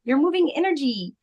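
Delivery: high, light Australian lilt imitating a healer; cute Australian accent. Shaman comforting a sick guest, imitated cute Australian accent. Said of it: "in her cute Australian accent".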